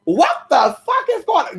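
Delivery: put-on high, shocked child voice; shocked little cousin. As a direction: squeal it like a shocked nine-year-old. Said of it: "she jumped up and she said".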